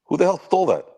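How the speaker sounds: yelling from the sidelines. Real, not acted. Fan reacting to steal.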